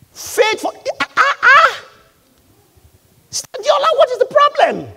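high, loud cries of disbelief; exasperated outcry. Acted. Preacher challenging someone who doubts God, exasperated outcry. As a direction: scold in high-pitched exasperation.

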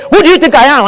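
loud, high-pitched mock challenge; acted indignation. Offended spouse challenging partner over refusal, acted indignation. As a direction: fire it back as if starting a fight. Acts out spouse starting a fight.

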